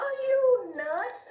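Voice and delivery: high, slow, stretched disbelief; high drawn-out are you nuts; incredulous. Retold or imitated. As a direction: drag it out like he's lost his mind. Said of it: "I said".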